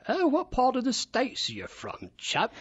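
mock English cabbie, chummy British accent; British cab driver. Guest imitates a London cabbie asking where he's from, British accent. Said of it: "the cab driver said".

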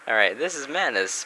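ordinary talk

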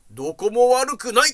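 put-on an old man loudly and bluntly announcing he's fine; mock loud blurt. The old patient answering the receptionist loudly and bluntly.

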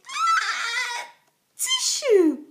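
high loud ah-tishoo; a huge dragon sneeze; big sneeze. A narrator's reading. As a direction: let the sneeze explode.

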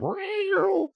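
high, soft mock meow; a quiet copied cat cry. Streamer imitating the fighting cats, high and soft. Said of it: "they're really going at it".

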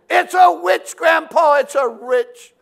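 put-on high, loud panicked child's cry; boy yelling to his grandpa about the witch; frightened yell. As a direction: shout it clinging to grandpa in panic.

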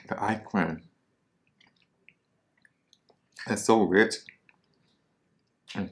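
talking between bites; muffled chewing speech; mouth full, chewing. Real, not acted. Seen 0: bites sandwich at 4.0, then chews behind hand while talking.

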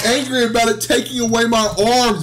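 angry protest climbing in pitch; exasperated, rising outrage. Real, not acted. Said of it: "I'm more angry".

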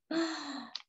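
a short, hushed gasp of pretend alarm; mock gasp. Reading to a child, gasping as Jane climbs the trapeze. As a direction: gasp like the child might fall.

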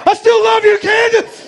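very high, loud victory shout to the ex; hammed-up declaration of love; triumphant shout. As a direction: scream it across the room at her. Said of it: "I said".

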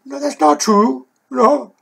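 retold protest. Narrator tells girlfriend it's not about race, indignant retold protest.

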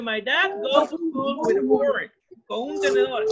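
high-pitched incredulous pupil reaction; mock astonishment. Teacher voices pupils hearing of dad's fork, mock astonished. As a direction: squeal it high in playful disbelief. Voicing pupils amazed at the silly sentence.

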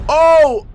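loud burst of surprise; sudden loud reaction cry; shocked "oh". Real, not acted. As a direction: shout a startled "oh!". Reacting to video.

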